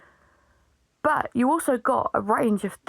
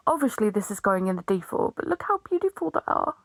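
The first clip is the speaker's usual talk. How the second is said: usual speaking voice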